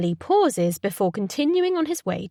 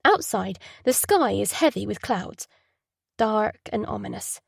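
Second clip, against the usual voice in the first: usual speaking voice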